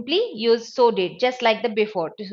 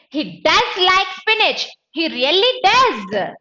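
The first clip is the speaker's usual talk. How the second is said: DOES punched high and loud both times; emphatic insistence; 7 semitones higher, 4 dB louder than usual. Shown as a demonstration. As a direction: insist firmly, stretching DOES. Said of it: "I'm adding more emphasis".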